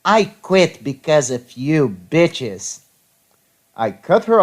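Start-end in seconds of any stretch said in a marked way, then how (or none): 0.0-2.8 slow, angry quote in a put-on voice; slow mock-angry outburst; angry retort. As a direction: act out an angry coworker quitting, drawn out. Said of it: "she starts out all angry".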